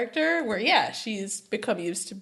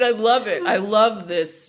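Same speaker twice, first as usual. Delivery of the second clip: normal talking voice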